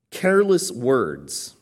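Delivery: "careless words" loud and very slow; emphatic. Retold or imitated. Preacher quoting the verse's key words, "careless words" slow and emphatic. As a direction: land hard on "careless words", drawing it out.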